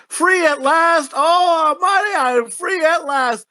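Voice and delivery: mock leprechaun proclamation. Leprechaun released from the stone, hammed-up triumphant cry. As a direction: ham up a freed leprechaun crying out. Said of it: "when he goes".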